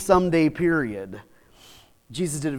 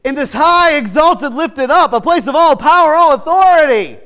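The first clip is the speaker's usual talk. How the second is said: loud, high building to a peak; preaching climax; 10 semitones higher, 10 dB louder than usual. Acted. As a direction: build the question up.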